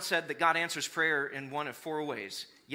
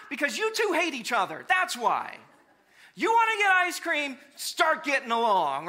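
put-on higher, louder lecture to the kids; scolding parent; 8 semitones higher, 7 dB louder than usual. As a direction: scold them until they get along.

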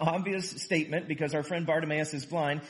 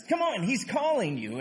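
higher-pitched urging call; 7 semitones higher than usual. A narrator's reading. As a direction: read it as an encouraging call. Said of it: "they called to the blind man".

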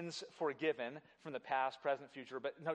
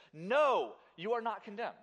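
"No" shouted higher and louder; emphatic no; 6 semitones higher, 7 dB louder than usual. Shown as a demonstration. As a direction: declare "No" with full force. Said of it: "that's the first word in the Greek".

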